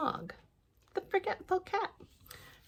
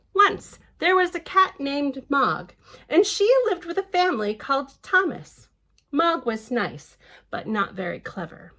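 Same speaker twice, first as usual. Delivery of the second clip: lively storybook reading voice; picture-book narration; reading to children; 5 semitones higher, 6 dB louder than usual. Acted. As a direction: read it out brightly to little ones. Reads the book aloud.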